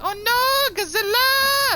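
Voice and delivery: hammed-up, very high falsetto screaming; mock mass panic. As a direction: play a terrified movie crowd fleeing a monster. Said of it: "They thought it was Godzilla".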